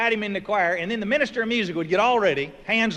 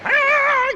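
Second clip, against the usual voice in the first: very high, loud mock tenor bellow; high loud beller; 6 dB louder than usual. Bubs belting a big hymn note, loud high bellow. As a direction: belt out a huge choir note. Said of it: "just beller".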